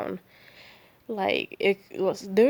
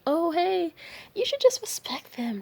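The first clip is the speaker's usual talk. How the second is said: meek well-meaning bystander; 10 semitones higher than usual. Acted. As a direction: imitate a timid do-gooder, pitched up. Voicing timid bystanders pleading tolerance.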